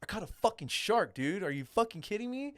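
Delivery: high-pitched disbelief without extra volume; retold freaking out. Angler reliving landing his shark, retold freak-out. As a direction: replay your amazed freak-out, pitch climbing. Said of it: "I was just freaking out".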